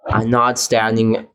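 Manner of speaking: usual speaking voice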